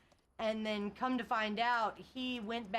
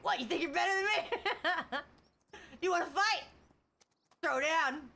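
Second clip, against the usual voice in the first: high, loud mock challenge to a fight; hammed-up picking a fight, louder and higher; mock tough guy; 7 semitones higher, 4 dB louder than usual. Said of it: "it's like".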